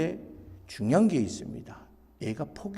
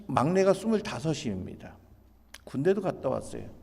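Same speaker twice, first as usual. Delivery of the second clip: ordinary talk, nothing marked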